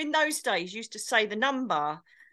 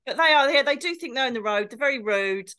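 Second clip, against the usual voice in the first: usual speaking voice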